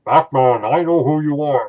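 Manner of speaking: loud, muffled villain through a mask; Tom Hardy's Bane. Retold or imitated. Imitating the movie villain to his foe, loud and muffled. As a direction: boom it out like a masked villain. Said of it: "Tom Hardy as Bane".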